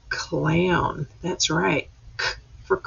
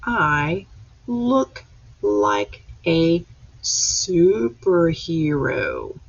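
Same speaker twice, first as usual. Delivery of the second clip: slow pointed reading; much slower than usual. Shown as a demonstration. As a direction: stretch each word slowly for little readers. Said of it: "So let's read this page".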